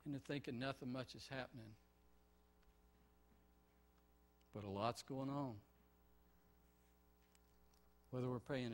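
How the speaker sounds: soft, low earnest prayer; hushed pastoral prayer. Acted. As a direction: pray quietly to the Father. Leads the congregation in prayer.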